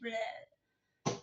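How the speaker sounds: long, quiet blurted bleh; spewing "bleh" noise. Shown as a demonstration. Showing how people tell their life story, spewed-out noise. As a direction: imitate words pouring out in one drawn-out noise.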